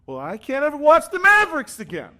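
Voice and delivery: put-on high, loud kid declaration; son's vow. Son giving up on the team, high loud kid voice. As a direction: swear it off like a heartbroken kid. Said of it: "the next thing he says is".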